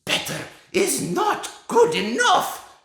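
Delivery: put-on very high, loud screech with a Slavic accent; a dad shrieking the witch's scolding; shrieking Grand High Witch. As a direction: shriek it with rolled R's. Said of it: "shrieked the Grand High Witch".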